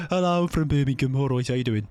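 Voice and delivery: mock Brummie announcement; exaggerated Brummie greeting; Birmingham accent. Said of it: "they just announce where they're from".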